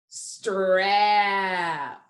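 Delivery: STRAP drawn out, every sound held; slow-motion strap, sliding through st, r, a to p; said slowly. Shown as a demonstration. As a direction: stretch strap so each blended sound can be heard. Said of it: "I'm going to say slowly".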